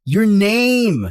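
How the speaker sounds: snapping the question loudly; exasperated, half-shouted demand. Acted. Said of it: "Don't yell at me".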